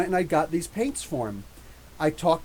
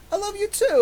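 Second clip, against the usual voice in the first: high mock voice; 14 semitones higher than usual. George Perez hugging, retold.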